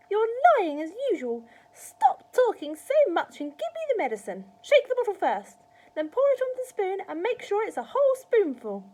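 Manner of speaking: high, loud grandma giving orders; bossy scolding. A narrator's reading. Grandma demanding medicine from George, bossy scolding. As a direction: order the boy about at the top of your voice.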